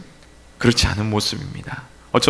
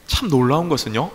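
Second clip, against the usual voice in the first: normal talking voice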